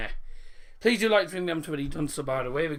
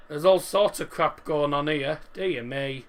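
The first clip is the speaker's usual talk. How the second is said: ordinary talk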